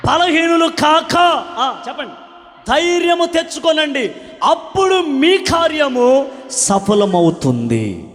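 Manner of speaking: loud, high, slow proclamation; full-force sermon cry; fiery preaching. Acted. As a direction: hammer the promise home to the crowd.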